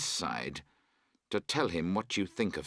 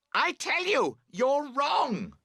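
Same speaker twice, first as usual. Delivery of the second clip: very high, loud scream of frustration; 5 dB louder, slower than usual. A narrator's reading. Camper screaming at his friend that he is wrong. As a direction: scream it at him across the tent. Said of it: "you scream".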